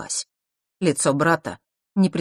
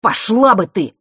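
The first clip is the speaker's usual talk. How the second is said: loud, raised angry burst; spat out; 6 semitones higher, 8 dB louder than usual. A narrator's reading. Nort tells his sister off, spitting it out. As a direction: spit the words out. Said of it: "словно выплюнул".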